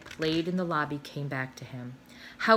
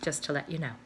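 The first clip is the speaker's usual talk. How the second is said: ordinary delivery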